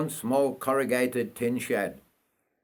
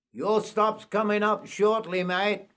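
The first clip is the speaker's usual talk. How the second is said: higher conductor voice; conductor calling; 9 semitones higher than usual. Acted. Conductor walking through the carriage, higher voice. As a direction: pitch the conductor up high.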